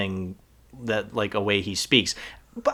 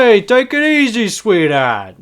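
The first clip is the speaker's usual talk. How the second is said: put-on New York tough-guy accent, starting much higher and louder; 10 semitones higher, 5 dB louder than usual. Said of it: "sounds more like New Jersey and New York".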